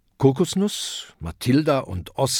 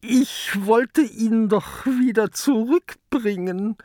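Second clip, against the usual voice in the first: high, hesitant creature voice; sheepish kraken; 11 semitones higher than usual. A narrator's reading. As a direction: make a lame excuse. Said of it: "stottert der Krake".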